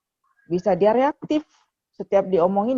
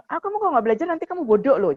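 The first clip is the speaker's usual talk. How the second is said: higher, quick mock telling-off; mock scolding; 8 semitones higher, faster than usual. Shown as a demonstration. Adviser showing a mother what not to say, mock scolding. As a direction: rattle off a parental warning, as a bad example. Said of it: "Ibu jangan ngekat gini".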